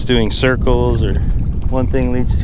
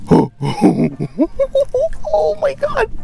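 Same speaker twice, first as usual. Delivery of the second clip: much higher, giddy squeals with a laugh; thrilled; 12 semitones higher than usual. Real, not acted. Angler hooking a snapper, squealing and laughing with joy. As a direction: burst out laughing as the fish fights.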